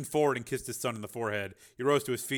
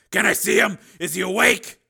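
anxious father; 9 semitones higher, 12 dB louder than usual. A narrator's reading.

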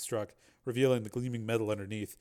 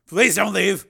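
loud, raised, urgent plea; desperate pleading; 9 semitones higher, 12 dB louder, slower than usual. Acted. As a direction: beg her to stay. Man begs her to stay.

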